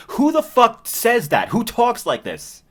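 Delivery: high, loud, slow disbelief; incredulous outburst. Real, not acted. Reviewer reacting to teenagers' dialogue, incredulous and loud. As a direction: demand it in exasperated disbelief.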